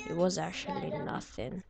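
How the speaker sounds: ordinary talk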